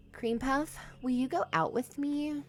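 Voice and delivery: ordinary delivery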